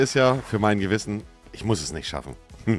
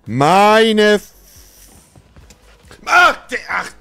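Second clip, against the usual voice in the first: berserk rage; 8 semitones higher, 7 dB louder, slower than usual. Real, not acted. Raging at failed jump.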